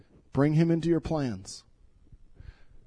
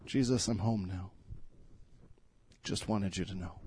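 much softer and lower, tender; hushed prayer; 8 semitones lower, 10 dB quieter than usual. Acted. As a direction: whisper it as a quiet prayer. Pastor repeats daughter's words as prayer.

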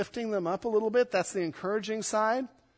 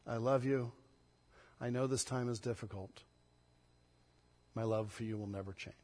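soft, low and tender; dad reassuring his son and daughter softly; gentle fatherly comfort; 7 semitones lower, 9 dB quieter than usual. Retold or imitated. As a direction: speak to your child as a loving dad would. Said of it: "A word of understanding from dad".

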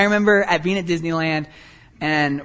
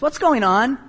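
put-on higher-pitched mom catching the kids; lightly voiced mom, rising question; 6 semitones higher than usual. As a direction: imitate a mother demanding an explanation. Mom walks in on fighting brothers.

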